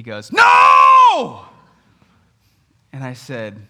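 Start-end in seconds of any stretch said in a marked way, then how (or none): 0.3-1.5 put-on loud, high toddler scream; high-pitched, full-force shriek of refusal. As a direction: scream it like an outraged small child. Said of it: "And he screams".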